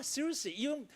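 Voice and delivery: ordinary delivery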